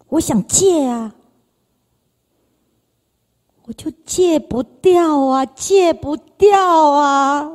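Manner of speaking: slow, dragged-out lament; strained, dragging cry; despairing plea. Retold or imitated. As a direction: stretch each word in helpless despair. Despair over quitting drink.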